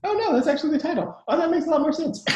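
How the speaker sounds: high-pitched, retold realisation; quick, high voice of sudden understanding, played up; mock realisation. Acts out own moment of realising.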